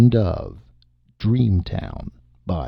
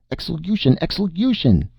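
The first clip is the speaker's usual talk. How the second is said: much higher, shrill chant of execution; shrieking child; 10 semitones higher than usual. Acted. Sally shrieking for the execution, much higher. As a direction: squeal it out with glee. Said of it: "Sally shrieked".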